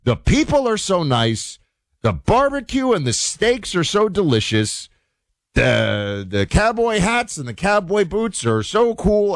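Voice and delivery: mock-amazed tourist quote; gushing tourist. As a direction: gush like a wide-eyed visitor. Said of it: "I won't do their accents".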